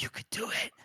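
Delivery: imitated intense whisper; whispered urging. Calder hugging Crawl as he urges him, whispered urging. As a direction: coax in a hushed, breathy whisper.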